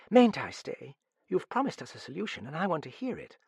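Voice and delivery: put-on high-pitched young woman's voice; pleading girl. A narrator's reading. Girl begging the detective to let her hear, pleading. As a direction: plead eagerly to be let stay.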